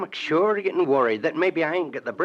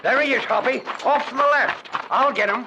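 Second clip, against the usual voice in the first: higher, louder calls; excited shout; 8 semitones higher, 3 dB louder than usual. Acted. Sidekick spots the boy after the shots and shouts urgently. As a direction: shout it out across the yard. Spotting the boy during gunfight.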